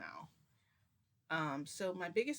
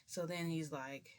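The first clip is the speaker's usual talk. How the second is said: plain reading voice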